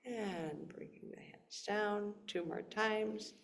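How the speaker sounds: soft unvoiced breathwork cue; whispering. Shown as a demonstration. Whispered cue during exercise.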